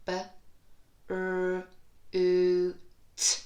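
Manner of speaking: F, l, oo, t each said alone with a loud oo; sound-by-sound. Shown as a demonstration. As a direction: tap out each sound on a finger. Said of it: "four sounds in this word".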